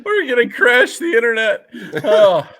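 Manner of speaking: high, loud, through the tail of laughter; laughing. Real, not acted. Host joking about posting the photos, laughing. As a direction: blurt it out while cracking up.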